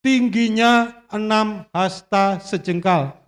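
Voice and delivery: slow, weighty Bible reading; dramatic scripture reading. Acted. Preacher reading Goliath's description to the church, slow and grand. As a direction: read it slowly like a grand tale.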